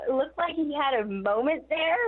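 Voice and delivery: usual speaking voice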